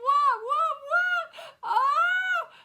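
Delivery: very high, loud re-enacted shrieks; high wailing cries of shock; shocked screaming. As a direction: shriek in disbelief, replaying your reaction. Re-enacts own shocked reaction.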